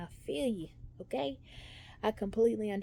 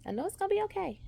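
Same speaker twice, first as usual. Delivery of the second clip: nervous reassurance; 12 semitones higher, 5 dB louder, much faster than usual. Real, not acted. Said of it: "I am a bit nervous".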